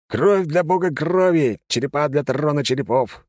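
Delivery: slow sinister proclamation; dark lord. A narrator's reading. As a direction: intone it like an evil overlord. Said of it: "зловеще произнёс я". (зловеще, медленно)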